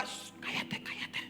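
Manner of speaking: whispered shh, cállate; hushing whisper. Retold or imitated. Dentist hushing the nervous father, whispered. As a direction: hush him in a whisper.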